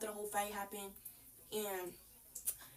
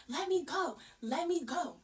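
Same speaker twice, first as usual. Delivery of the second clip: mild mock yell, pitched up; retold scream; 5 semitones higher, slower than usual. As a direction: imitate screaming to be released without full volume. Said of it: "now I'm screaming".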